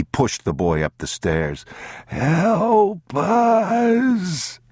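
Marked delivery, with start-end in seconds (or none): 2.1-4.6 high, loud, long wail; calling out. A narrator's reading. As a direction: cry out from the dark cellar. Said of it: "they called".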